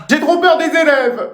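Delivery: put-on high, slow childish whine; whining fear. Reluctant teacher to director about pupils, whining fear. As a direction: whine it like a frightened kid.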